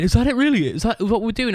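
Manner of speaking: high, incredulous whine of a bandmate; whiny bandmate. Retold or imitated. Imitating the other members asking if the band is over.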